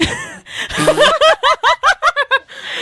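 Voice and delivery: long, high-pitched laughing fit; real high giggling fit; helpless laughter. Real, not acted. Cracking up at the horse story.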